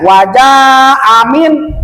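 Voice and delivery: Wajah amin slowly, the jah held long; long vowel. Shown as a demonstration. Teacher repeats the correct reading, Wajah drawn out. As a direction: draw out Wajah before amin. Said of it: "Jadi panjang sebab ada bendera".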